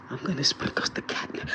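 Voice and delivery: hushed, unvoiced whisper. Real, not acted. Cat owner announces the catnip experiment, whispering. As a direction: whisper it, barely any voice. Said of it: "I don't know why I'm whispering".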